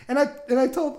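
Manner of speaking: ordinary talk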